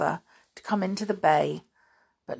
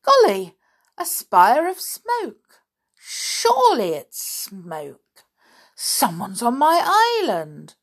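high, loud, slowed alarm; indignant surprise, drawn out; startled exclamation; 5 semitones higher, 5 dB louder, slower than usual. Acted. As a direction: cry out at the sight of smoke. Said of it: "George gave an exclamation".

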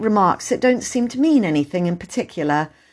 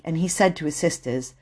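plain reading voice

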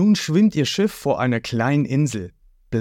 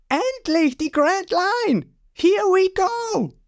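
high, slow, gleeful cartoon boy voice; excited boy hero; slower than usual. Ruffy greeting the Grand Line, high gleeful cheer. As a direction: cheer it out like an eager young captain.

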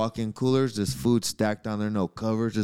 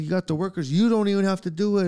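raised, louder retold rant; higher and louder mock complaint; 9 semitones higher, 4 dB louder than usual. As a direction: talk it up loud like telling off the boss. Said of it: "I was like".

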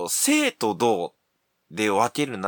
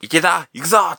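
loud call to a player; shout; 6 semitones higher, 7 dB louder than usual. Acted. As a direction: yell it like a coach. Coach calling to player.

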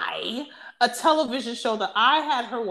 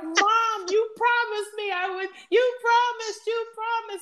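imitating an upset kid, high-pitched pleading; high mock wail, pleading and repeating; mock tearful; 12 semitones higher than usual. Retelling an eliminated girl pleading with her mom, mock tearful. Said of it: "So she was crying. She was upset".

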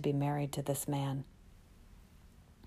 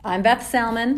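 loud, higher host voice; announcer intro; 7 semitones higher, 10 dB louder than usual. Pretend. Host introducing herself to listeners, loud. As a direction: introduce yourself like a radio host.

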